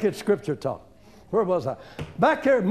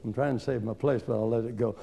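lower, softer muttered aside; quiet aside; 10 semitones lower, 5 dB quieter, faster than usual. Real, not acted. Preacher muttering an aside to himself about his notes. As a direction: drop your voice for a side remark.

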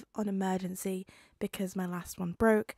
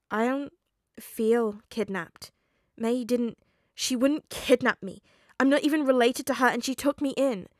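louder, rising distress as he denies it; upset protest; 3 semitones higher, 9 dB louder than usual. Acted. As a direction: refuse to believe it, voice climbing. Peter protests the kidnapping claim.

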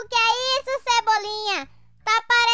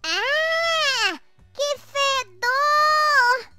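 slow, high, disgusted wail; disgusted groan; 5 semitones higher, much slower than usual. Acted. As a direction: groan at the awful stink. Smelling Cascão's armpit.

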